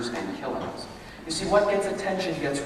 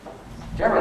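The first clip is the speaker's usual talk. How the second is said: ordinary delivery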